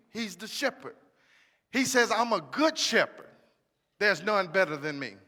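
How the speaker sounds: quiet pastoral close over worship; soft closing exhortation. Acted. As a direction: ease the room into worship. Soft altar-call exhortation.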